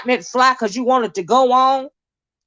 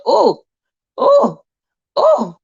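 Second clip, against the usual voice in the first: rising gasping oh, oh, oh, jumping way up on the middle one; shocked oh; 7 semitones higher, 4 dB louder, much slower than usual. Retold or imitated. Imitating her own shock on hearing the breakup. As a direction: act floored by the news. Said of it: "I was like".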